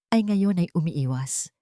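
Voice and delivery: normal talking voice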